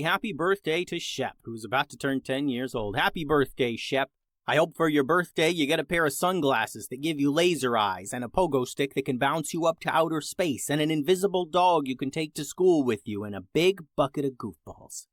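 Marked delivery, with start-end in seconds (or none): none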